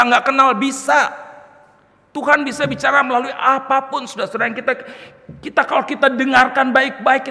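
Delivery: high, loud, emphatic preaching; impassioned preaching. Real, not acted. Preacher telling the congregation God speaks through anything, impassioned preaching. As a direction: preach it out at full force.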